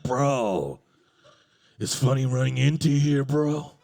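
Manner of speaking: deep, loud bro voice; stalker voice. Retold or imitated.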